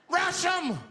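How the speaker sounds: high, loud charging yell; rally the bus to attack; screamed order. Retold or imitated. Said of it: "He screamed out".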